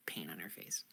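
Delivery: hushed unvoiced whisper. Player whispering the character's pain. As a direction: breathe it out.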